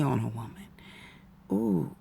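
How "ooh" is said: usual speaking voice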